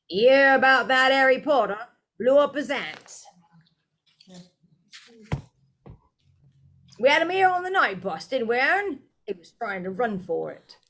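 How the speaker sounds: high, loud boastful telling; lightly voiced conductor bragging to passengers; bragging gossip. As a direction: crow about it to passengers. Stan imagined bragging.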